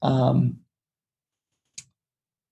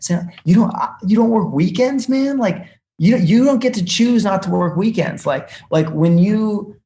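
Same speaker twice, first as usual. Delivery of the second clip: higher, louder indignant rant at a friend; imagined rant; 4 semitones higher, 5 dB louder than usual. Retold or imitated. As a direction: scold him like he's spoiled. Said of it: "I was like".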